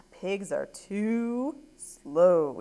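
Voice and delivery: slow, dragged-out grumble; drawn-out complaint. Acted. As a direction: stretch the words out as an impatient sheep. Sheep tired of slow pigs.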